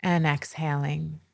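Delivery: slow, measured meditation voice with long pauses; unhurried, soothing breathwork narration; guided meditation. Acted. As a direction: guide listeners gently into stillness, leaving space between phrases.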